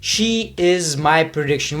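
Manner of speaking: ordinary talk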